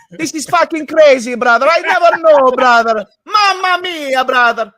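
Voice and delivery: very high, loud cartoon Italian; Italian accent. Exasperated player to his brother, over-the-top Italian accent. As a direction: exclaim in a hammed-up Italian accent. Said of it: "that doesn't sound Italian".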